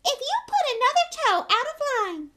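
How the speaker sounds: mock mother screeching a threat; piercing mocking impersonation; shrill, piercing voice. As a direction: put on a very high, loud scolding voice. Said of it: "He put on a shrill, piercing voice".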